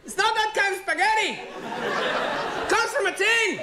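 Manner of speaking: high, half-laughing protest; comic outrage. Acted. Comedian insists to the audience in mock outrage. Outraged over tinned spaghetti.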